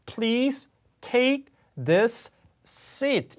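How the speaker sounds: "Please take this seat" very slow, word by word, the S sounds doubled; painfully slow, unlinked "this seat"; wrong, choppy. Shown as a demonstration. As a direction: drag it out, separating every word, the wrong way. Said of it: "이렇게 할 수 없어요".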